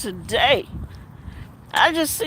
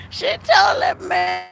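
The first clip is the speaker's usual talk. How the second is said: laughing; 6 semitones higher than usual. Real, not acted. Laughing while retelling.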